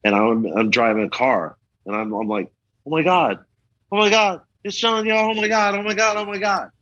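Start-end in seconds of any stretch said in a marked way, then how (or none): 2.8-6.7 high-pitched, breathless-style fan freak-out, acted out; starstruck excitement. Driver spotting [name] and [name] on the sidewalk, starstruck. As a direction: re-enact a rush of starstruck disbelief.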